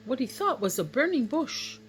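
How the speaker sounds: ordinary delivery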